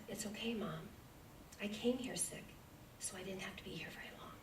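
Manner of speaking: whispered gently, almost breathless words; hushed whisper. Retold or imitated. Young son whispering comfort to his mother, hushed. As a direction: whisper it softly, as if consoling.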